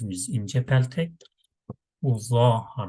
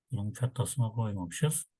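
ordinary delivery

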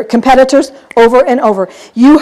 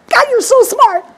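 mock admiration; 10 semitones higher than usual. Mock praise of lender.

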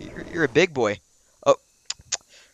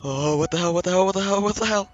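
rising alarmed repeats; startled, getting more frantic; panicked; 3 semitones higher, faster than usual. Real, not acted. Sudden danger in game.

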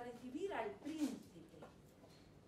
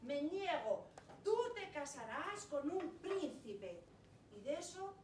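loud, stern refusal; father forbidding his daughter's wish after slamming the table; angry father; 3 dB louder than usual. Acted. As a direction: fist on the table, put your foot down. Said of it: "pegó un puñetazo en la mesa y dijo".

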